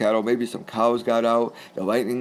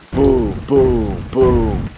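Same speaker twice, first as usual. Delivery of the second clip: loud booming thunder noises, one per strike; imitated thunder booms; 7 dB louder, much slower than usual. Telling listeners about the storm, loud mock thunder booms. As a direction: boom out each thunderclap.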